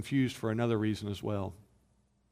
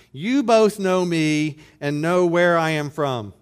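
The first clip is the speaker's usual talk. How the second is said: loud, slow and high, a public proclamation; crying out loud; 5 semitones higher, 7 dB louder, slower than usual. Acted. Preacher voicing Jesus calling to the crowd, loud and slow. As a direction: cry it out across the temple. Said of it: "He, he cried out".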